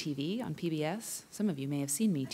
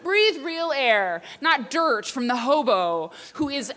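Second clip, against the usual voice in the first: high, loud scolding yell; screeching high scold, slowing at the end; mock yell; 10 semitones higher, 7 dB louder than usual. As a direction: shout at the mother to leave. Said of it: "I was like".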